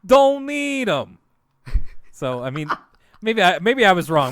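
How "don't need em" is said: high, loud, very slow brush-off; dismissive repairman. Retold or imitated. Cohost voices the goat shrugging off instructions, loud and slow. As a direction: drag out a smug refusal. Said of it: "He's just like".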